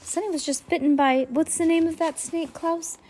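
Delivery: high, agitated question; still panicked. A narrator's reading. Mister Poe asking Klaus about the snake, agitated. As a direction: ask in a frantic rush.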